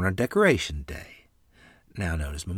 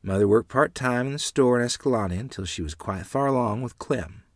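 ordinary delivery

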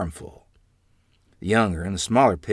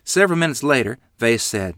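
usual speaking voice

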